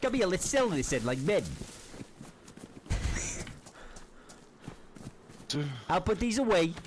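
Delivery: swaggering street thug voice; cocky nervous street slang. Acted. Tough-guy roleplay with stranger.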